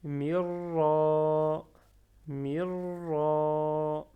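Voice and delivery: Mirra with long trill and held final vowel; doubled r, prolonged a. Shown as a demonstration. Teacher showing shadda and prolongation, Mirra drawn out with doubled r. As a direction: roll the r, then stretch Mirra's ending loudly. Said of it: "Avec shadda et prolongement".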